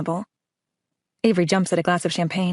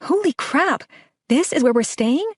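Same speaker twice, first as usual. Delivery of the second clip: sudden high squeal of amazement, then slower; amazed; 8 semitones higher, slower than usual. A narrator's reading. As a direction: gasp it out in awe at the hotel. Avery amazed at the hotel.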